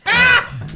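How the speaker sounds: sharp high cry of pain; pained yelp. Real, not acted. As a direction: yelp as the sauce stings. Hot sauce in the eye.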